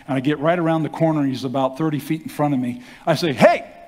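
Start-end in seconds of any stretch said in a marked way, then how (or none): 3.3-3.7 sharp high call, much louder; loud hey. Retold or imitated. Young man calling out to the hypnotist, loud high shout. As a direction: call out to stop him in the lot. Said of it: "I can be loud".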